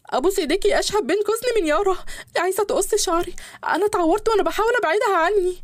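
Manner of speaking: terrified maid begging her husband; frightened pleading. Acted. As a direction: plead for protection, scared. Injured maid begs in fear.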